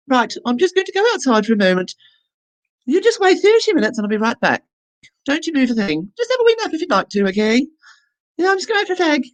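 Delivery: high sing-song beautician impression. Beautician leaving her client for a smoke, high mock voice. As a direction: pitch it up like the chatty beautician.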